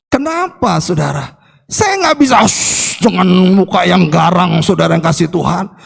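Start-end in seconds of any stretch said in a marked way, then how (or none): 2.5-3.0 father's wordless warning hiss; stern shush. Retold or imitated. Father silencing his child without words, stern shush. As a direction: imitate a stern, sharp shush. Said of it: "papa cukup dengan".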